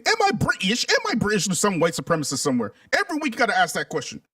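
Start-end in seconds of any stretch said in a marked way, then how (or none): 0.0-1.5 high-pitched mock British questioning; mock British accent. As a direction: imitate a silly wannabe in British voice. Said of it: "Silly wannabe vanilly dilly asking".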